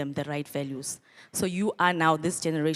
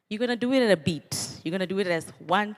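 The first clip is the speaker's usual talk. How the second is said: usual speaking voice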